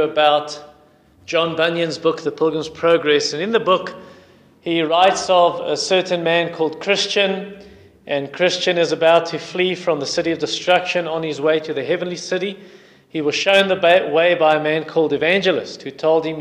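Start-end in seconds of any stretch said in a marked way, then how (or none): none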